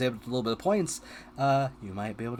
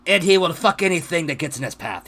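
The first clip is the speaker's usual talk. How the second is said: louder, higher hammed-up French accent; French gnome character; 5 semitones higher, 5 dB louder than usual. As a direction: boast it in a fake French accent. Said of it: "had that accent".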